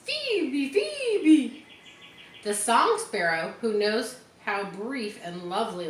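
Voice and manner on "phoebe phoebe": high chirped Phoebe, Phoebe; high lilting birdcall. A narrator's reading. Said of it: "and says".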